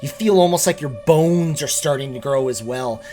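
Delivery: measured narration with punched key words; game master's scene setting. Acted. Game master tells players night is falling, dramatic scene setting. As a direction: build the dread, then ease down to the ruling.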